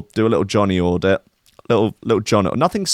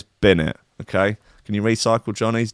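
ordinary delivery

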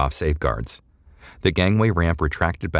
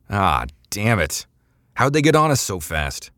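pained outburst; 4 semitones higher than usual. A narrator's reading. Decker after hurting his arm, pained and angry. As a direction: yelp from the pain, then demand an answer. Slaps console, pain shoots arm.

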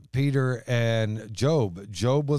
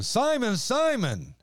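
Simon, Simon called out much higher, urgently; Simon, Simon rising high with urgency; emphatic call; 12 semitones higher, slower than usual. Acted. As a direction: call his name like an exclamation point. Jesus gets Peter's attention.

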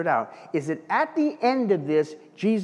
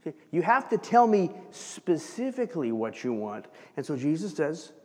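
ordinary talk

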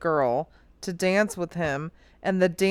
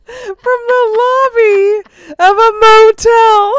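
laughing; 13 semitones higher, 11 dB louder than usual. Real, not acted. Laughing while telling the story.

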